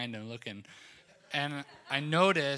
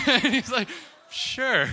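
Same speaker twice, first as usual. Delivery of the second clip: laughing; 11 semitones higher, 9 dB louder, slower than usual. Retold or imitated. Laughing retelling his answer.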